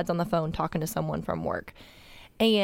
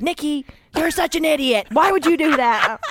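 louder, higher scolding yell; dad scolding; 7 semitones higher, 6 dB louder than usual. Retold or imitated. Dad scolding Nikki for the flash, mock angry. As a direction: berate her like an annoyed dad. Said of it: "He's like".

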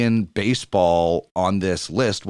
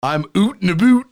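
hammed-up Canadian "oot and a boot", vowels rounded to oo; a playful jab at how Americans hear Canadians; mock Canadian accent; 8 semitones higher, 4 dB louder than usual. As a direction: poke fun with an exaggerated Canadian "oot and a boot". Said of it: "I've never said".

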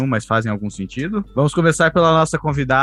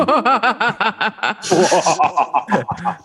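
higher cackling witch laugh; witch cackle; 7 semitones higher than usual. Acted. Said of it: "risada de bruxa?".

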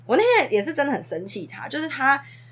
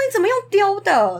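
higher and louder, startled rebuke; shocked teacher; 9 semitones higher, 3 dB louder than usual. Retold or imitated. Teacher reenacting her shock at the girl hurling a toy, raised voice. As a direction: replay the jolt of seeing it thrown.

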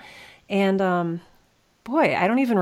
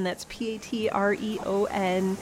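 ordinary talk, nothing marked

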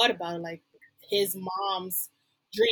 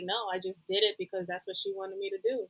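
softer, resigned retelling; unexcited, resigned; 8 dB quieter than usual. Retold or imitated. Retelling a man who settled for engineering, unexcited. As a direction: imitate someone who settled, little energy. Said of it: "he didn't sound excited about engineering".

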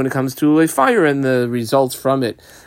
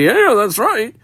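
higher-pitched imagined agreer; voiced agreement; 9 semitones higher than usual. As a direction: chime in as someone readily agreeing. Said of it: "and if you wanna say".

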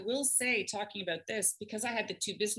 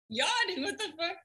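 incredulous; 11 semitones higher than usual. Real, not acted. Mock outrage at the yawning dog.

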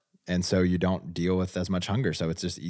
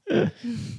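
laughing. Real, not acted. Laughs at the cartoon voice.

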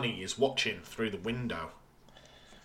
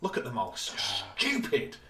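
condescending sneer; 2 semitones lower than usual. Retold or imitated. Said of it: "probably speaking down at the bad kids".

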